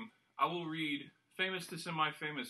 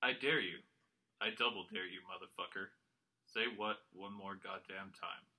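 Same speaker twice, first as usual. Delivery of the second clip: low, quiet, even monotone; deadpan quote in a lowered monotone; monotone quote reading; 8 semitones lower, 5 dB quieter than usual. Shown as a demonstration. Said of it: "read these all in the same monotone voice".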